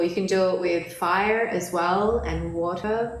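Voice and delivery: normal talking voice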